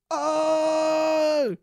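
loud high yell. Acted. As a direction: shout it out. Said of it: "yelled Tom".

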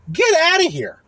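high, fed-up dismissal; exasperated. Real, not acted. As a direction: wave them off in disbelief. Exasperated reaction.

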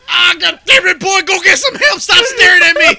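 put-on high, loud cranky old-timer yell; old prospector. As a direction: holler like a dying old coot. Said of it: "my granddaddy's last word".

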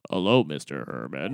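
a dubbed stranger's voice; dubbed-over voice. Retold or imitated. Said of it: "That's obviously not you".

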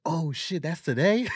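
high, loud burst of surprise; mock alarmed realization. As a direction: act out suddenly remembering, hammed up. Acting woman remembering an appointment.

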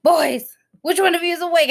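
mild mock parental yell; retold yell. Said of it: "I even, like, yelled".

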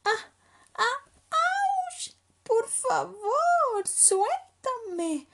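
high, loud squeezed yelps; slow strained plea, as if crushed in a hug; squealing little cub gasping for release; suffocated. Acted. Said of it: "dijo Leopardito sofocado".

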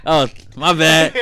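high, laughing and loud; laughing mock voice. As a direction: laugh through a mocking voice. Mocking a careless saver.

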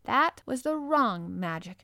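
slow, lordly dismissal; slow and grave. Acted. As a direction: rajah waving it off slowly. Colin waves hand, slow.

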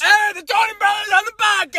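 very high, screamy announcement; Tony Brothers voice. Acted. Announcing the brothers on the podcast, screamy yell. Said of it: "That's our Tony Brothers voice".

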